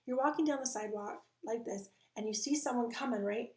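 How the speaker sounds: ordinary talk, nothing marked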